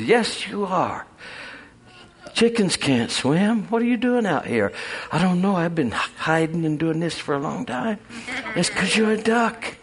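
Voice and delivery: a kindly duck setting a chicken straight; lightly voiced duck.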